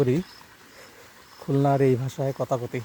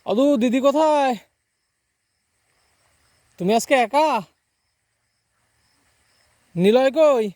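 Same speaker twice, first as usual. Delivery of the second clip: loud, much higher shout across the field; calling across field; 10 semitones higher, 6 dB louder, slower than usual. Real, not acted. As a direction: shout over the distance to grandpa.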